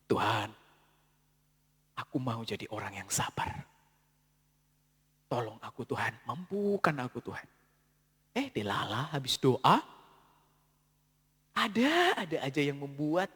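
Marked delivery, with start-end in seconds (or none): 0.1-7.5 low, soft pleading prayer voice; quiet prayer. Retold or imitated. Believer praying softly to God, quiet plea. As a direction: murmur it like a humble prayer.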